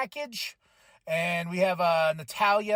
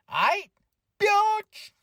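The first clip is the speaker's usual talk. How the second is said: high sassy snap; made-up sassy retort; 12 semitones higher, 4 dB louder than usual. Pretend. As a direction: put attitude on it. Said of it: "she didn't say that".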